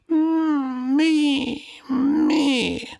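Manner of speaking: tiny high lamb's whimper, far off; a faint bleating cry for help; tender whimper. Acted. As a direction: mew it like a weak newborn lamb. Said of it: "ein zartes Wimmern".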